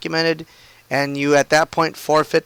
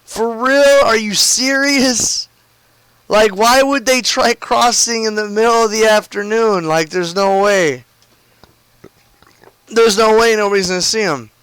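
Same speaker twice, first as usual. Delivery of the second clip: mock the shock of seeing them run; retelling a coworker's astonished outburst; freaked-out disbelief; 6 semitones higher than usual. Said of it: "And he freaked out".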